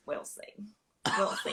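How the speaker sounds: very soft, knowing "we'll see"; playful doubt, near-hushed; teasing murmur. Retold or imitated. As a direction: tease him quietly, unconvinced. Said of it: "And I said".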